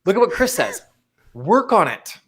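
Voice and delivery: ordinary talk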